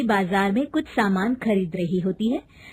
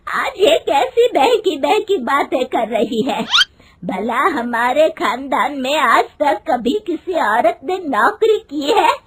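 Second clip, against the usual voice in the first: loud, higher, furious mother-in-law; angry; 6 semitones higher, 6 dB louder than usual. Acted. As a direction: scold the daughter-in-law in a raised, outraged voice. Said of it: "उसकी सास गुस्से में कहती है".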